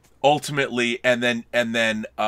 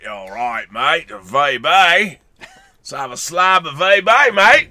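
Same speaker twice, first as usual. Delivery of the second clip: hammed-up Aussie, stretched vowels, building louder; Australian accent; 7 dB louder, slower than usual. Podcaster shows off his Australian accent, broad and hammed-up. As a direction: lay on a broad Australian drawl. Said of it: "that's my Australian accent".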